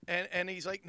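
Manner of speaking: ordinary delivery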